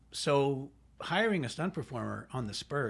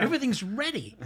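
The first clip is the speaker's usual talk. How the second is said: high, loud retold protest; mock-incredulous complaint; exasperated disbelief; 8 semitones higher, 5 dB louder than usual. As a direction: insist it's too late, pitch raised. Coordinator exasperated at late request, retold.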